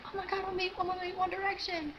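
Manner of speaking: high squealing fangirl; high-pitched retold excitement; excited squeal. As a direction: squeal it like a thrilled fan.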